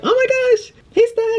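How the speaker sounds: very high-pitched fangirl squeal; mimicked excited fans. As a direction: imitate a starstruck fan squealing. Fans stopping her, mimicked.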